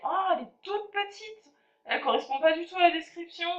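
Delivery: surprised, then hurrying through the letdown; disappointed surprise. Real, not acted. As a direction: let down by the tiny chain. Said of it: "je suis déçue".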